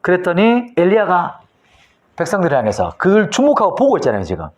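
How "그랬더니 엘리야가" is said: ordinary talk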